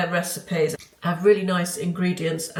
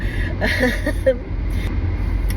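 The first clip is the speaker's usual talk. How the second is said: nervous laugh; 5 semitones higher than usual. Real, not acted. Laughs at own worry.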